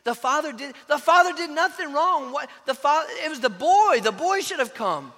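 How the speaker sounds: high, loud disbelief; shocked audience. Retold or imitated. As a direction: cry out in outraged shock. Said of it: "They were like".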